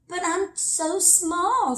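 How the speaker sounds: put-on high, slow little-girl voice; high and slow, a small girl protesting. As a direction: voice Little Nell as a tiny timid girl. Small girl's dialogue.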